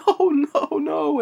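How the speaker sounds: high, play-acted "oh no"; high mock lament; mock anguish. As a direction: imitate a father's dismay in a high voice. Retelling daughter dying in arms.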